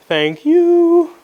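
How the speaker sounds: loud, very high sing-song "you"; drawn-out, high-pitched playful thank-you. Real, not acted. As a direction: leap way up and hold the last word.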